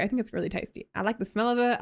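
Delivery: usual speaking voice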